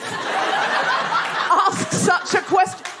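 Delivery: drawn-out vow. Real, not acted. Host vowing never to ask again.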